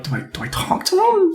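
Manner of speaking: softly voiced, unsure aside; hesitant aside. Unsure customer asks a friend about the silent barkeep, hushed aside. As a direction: murmur it as if he might overhear.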